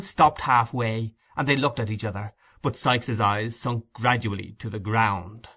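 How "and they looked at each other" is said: ordinary delivery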